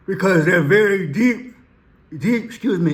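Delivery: stifle a yawn mid-sentence. Real, not acted. Host excusing himself for yawning while praising his videos, yawning. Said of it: "Excuse me for yawning".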